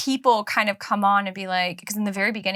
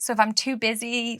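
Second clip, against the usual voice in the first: ordinary talk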